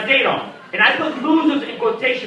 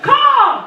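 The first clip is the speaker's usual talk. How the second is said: loud, high eagle screech; eagle caw; 5 dB louder than usual. Retold or imitated. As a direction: screech like an eagle, full volume. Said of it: "make noises like an eagle".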